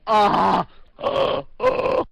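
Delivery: ordinary talk, nothing marked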